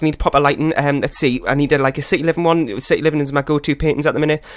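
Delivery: ordinary delivery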